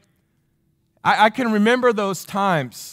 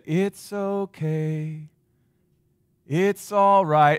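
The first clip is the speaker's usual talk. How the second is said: soothing lullaby; 4 semitones lower, much slower than usual. Retold or imitated. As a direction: hush a frightened child back to sleep. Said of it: "in this soothing voice".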